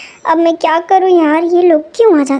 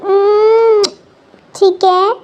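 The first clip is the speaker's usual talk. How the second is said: ordinary delivery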